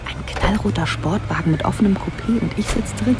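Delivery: loud, excited gush; thrilled. Acted. Young woman thrilled to ride in the boss's sports car. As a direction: burst out with delight.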